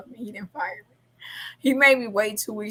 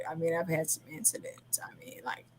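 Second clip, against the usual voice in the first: whispered aside; 3 semitones lower, 11 dB quieter than usual. Real, not acted. Whispered aside about incidents.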